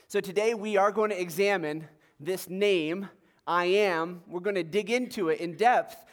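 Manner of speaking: usual speaking voice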